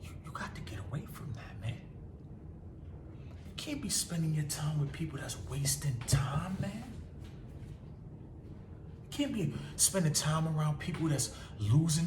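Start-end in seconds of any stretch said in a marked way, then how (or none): 0.0-6.8 whispered urgent advice; a secret warning, breathed out; hushed warning. Real, not acted. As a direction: drop to a whisper and lean in. Sudden hushed warning to viewer.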